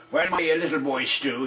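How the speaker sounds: normal talking voice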